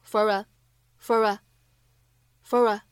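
"fur a" run together slowly as one word; linking demo. Shown as a demonstration. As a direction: glide the r straight into "a" in "fur a". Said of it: "可以有一个非常自然的连读". (慢速示范"fur a"连读)

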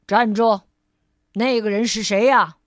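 loud stern halt; guard shout. Acted. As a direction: challenge the visitors at the gate. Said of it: "叫道". (卫士大声喝止)

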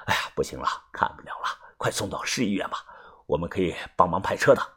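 low, soft, resigned doctor; quiet doctor. A narrator's reading. Doctor telling them to go to the city hospital, low and resigned. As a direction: sigh out the bad news. Doctor giving bad news.

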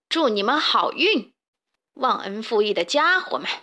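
mild huffy indignation; huffing angrily. A narrator's reading. Dick storming out of the henhouse, huffing angrily. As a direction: quit in a sulky huff. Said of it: "迪克边说边气哼哼地走出鸡舍".

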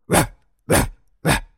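loud, high barking; dog barking. Acted. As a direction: bark like a small dog.

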